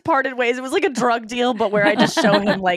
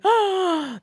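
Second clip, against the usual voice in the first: high, gasped "oh"; mock swooning gasp; 9 semitones higher than usual. Woman spotting her boyfriend on YouTube, high retold swoon. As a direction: gasp high as if struck by attraction.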